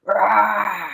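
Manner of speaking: long playful lion roar. Pretend. Storyteller showing children a lion roar, playful. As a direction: let out a drawn-out pretend roar. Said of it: "where's your lion roar?".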